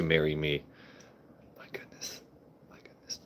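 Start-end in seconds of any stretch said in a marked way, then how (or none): 1.6-3.2 breathy whispered "my goodness" to herself; whispering to herself after the proposal. Real, not acted. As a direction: hush it out, flustered and amused. Flustered by a marriage proposal.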